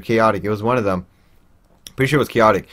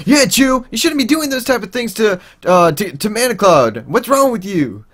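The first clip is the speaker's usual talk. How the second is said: much higher scolding voice; mock sister scolding; 11 semitones higher than usual. Sister [name] joining in, high mock scolding.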